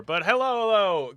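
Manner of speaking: loud, high excited hello; excited greeting. Real, not acted. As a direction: call out hello brightly.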